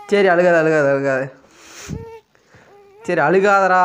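half-exasperated hushing; loud hushing. Real, not acted. Hushing the crying baby.

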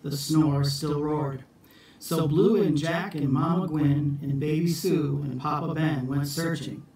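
ordinary delivery

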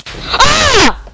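a sudden high yelp of shock; high startled scream, real shock. Real, not acted. Dies to a creeper in game.

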